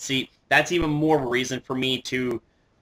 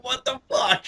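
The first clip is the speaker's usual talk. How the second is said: high-pitched amused bafflement; mock disbelief; 11 semitones higher than usual. Fan reacting to the bickering champions, amused disbelief.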